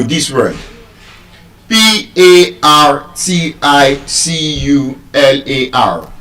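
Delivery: ordinary delivery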